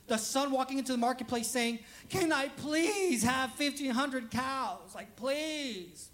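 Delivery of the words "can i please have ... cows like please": drawn-out mock pleading; begging. Son pleading in the marketplace, hammed-up begging. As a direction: stretch out PLEASE like a desperate beggar. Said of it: "you keep begging so much".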